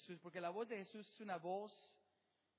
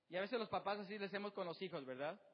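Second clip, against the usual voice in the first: ordinary delivery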